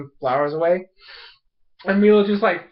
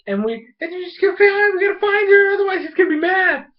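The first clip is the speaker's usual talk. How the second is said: high, fast run-on chatter; babbling girl; 14 semitones higher, 3 dB louder than usual. Retold or imitated. Ikki explaining herself to captors, high fast babble. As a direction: gabble it in a raised mock voice.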